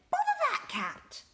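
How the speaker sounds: very high, exasperated mother; cross mum voice. A narrator's reading. Mum woken and cross with the cat, very high. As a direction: groan it like a fed-up mum. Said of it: "Missus Thomas said".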